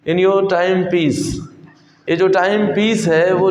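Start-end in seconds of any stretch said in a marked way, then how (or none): 0.1-1.2 model question. Shown as a demonstration. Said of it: "लोग कहते हैं".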